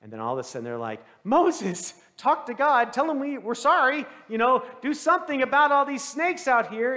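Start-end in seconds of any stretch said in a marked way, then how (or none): 1.2-7.0 loud, high acted begging; hammed-up desperate appeal, voice raised; panicked plea. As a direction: call out urgently for help. Panicked people beg Moses for help.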